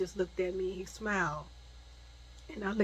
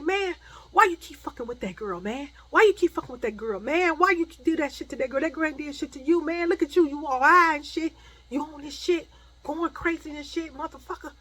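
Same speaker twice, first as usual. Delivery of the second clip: high, loud, fast berating; angry scolding; 7 semitones higher, 7 dB louder than usual. Retold or imitated. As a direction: tear into him, rapid and indignant. Acted cousin scolding the attacker.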